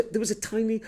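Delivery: ordinary talk, nothing marked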